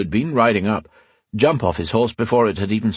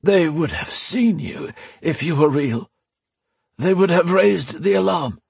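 much higher, rising with hope; relieved Alesh; 9 semitones higher than usual. Acted. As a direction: grasp at a reason with relief. Alesh voice high.

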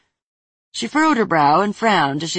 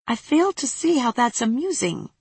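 disapproving; 7 semitones higher than usual. Acted. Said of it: "Tina frowned".

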